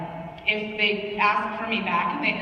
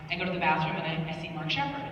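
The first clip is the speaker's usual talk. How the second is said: ordinary talk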